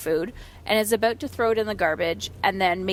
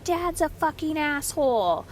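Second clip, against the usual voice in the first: higher-pitched mock angry outburst; imitating angry son; 6 semitones higher than usual. Son grumbles to mom about dad, mock angry. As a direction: retell an angry kid's complaint, pitched up. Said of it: "and he's like".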